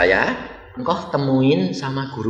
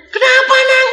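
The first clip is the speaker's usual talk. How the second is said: high, startled voice for the question; high fatherly question; 6 dB louder than usual. Retold or imitated. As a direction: act out the startled father asking. Father asks, son explains. (ayah bertanya dengan suara tinggi terkejut)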